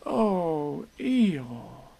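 sorry. Acted. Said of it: "feeling very sorry already".

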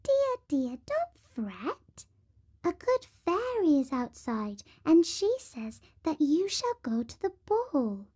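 high squeaky mouse voice; tiny, high-pitched mouse consoling a girl; little mouse. A narrator's reading. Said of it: "A mouse came up to her and said".

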